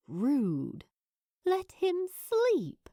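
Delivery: usual speaking voice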